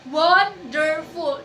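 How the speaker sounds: "Wonderful" spoken slowly to mark its three syllables; syllables counted out. Shown as a demonstration. Teacher slows "Wonderful" so the class can count syllables. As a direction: stretch "Wonderful" out so each syllable is heard. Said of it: "How many syllables in wonderful word?".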